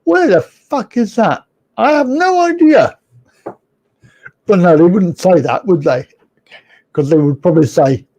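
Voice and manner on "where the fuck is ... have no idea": high-pitched baffled foreigner; mock bewilderment. Foreigners hearing the name Wiltshire, mock bafflement. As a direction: climb higher into exaggerated cluelessness. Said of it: "they go".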